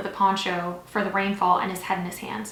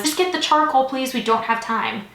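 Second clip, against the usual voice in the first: loud, high order easing as it goes; urgent interrupting; 5 semitones higher, 6 dB louder than usual. A narrator's reading. Doctor cuts off the nurse for charcoal, urgent interrupting. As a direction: cut in to hurry them.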